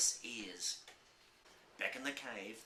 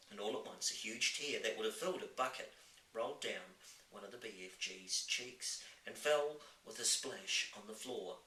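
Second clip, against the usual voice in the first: ordinary delivery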